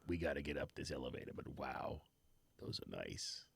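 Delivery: muffled behind a hand; hand over mouth. Real, not acted. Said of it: "since you're doing a muffled voice".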